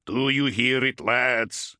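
booming warrior roar over the din. A narrator's reading. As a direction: roar it over engine noise. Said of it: "he roared above the din".

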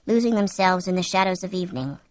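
plain reading voice

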